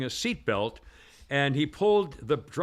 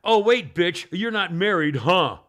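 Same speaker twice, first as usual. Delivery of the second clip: higher, louder retold screaming rant; mock screaming; 5 semitones higher, 6 dB louder than usual. Pot store owner screaming threats at the calm trooper, loud. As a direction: hammer him with insults. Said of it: "She's still screaming at him".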